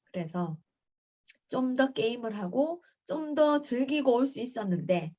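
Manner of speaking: normal talking voice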